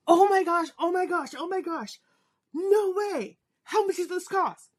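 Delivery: high, thrilled squealing repeats of oh my gosh; son's excitement. Retold or imitated. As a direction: imitate an overjoyed kid seeing his idol. Son sees [name] cutout.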